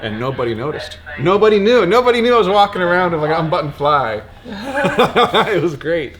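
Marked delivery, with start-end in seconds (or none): 1.2-4.2 excited. Real, not acted. Gleeful confession. 4.5-6.2 laughing it out; giggly through the words. Real, not acted. Laughing at own confession.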